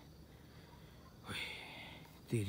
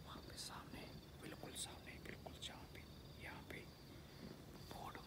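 soft whisper, barely voiced; tense hushed whisper in the dark; 9 semitones lower, 18 dB quieter than usual. Real, not acted. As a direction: whisper so the thing out there won't hear. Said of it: "धीरे धीरे बोल रहा हूँ".

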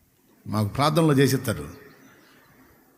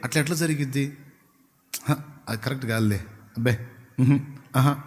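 softer, quick dismissive doubter; mock sceptic tutting it away; sceptical scoffing; 3 semitones lower, 5 dB quieter than usual. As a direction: brush the claim off like a know-it-all. Educated man doubting a claim.

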